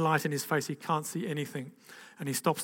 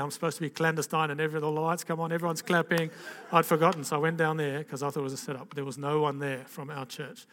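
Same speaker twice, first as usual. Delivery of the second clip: usual speaking voice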